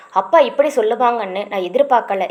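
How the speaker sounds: usual speaking voice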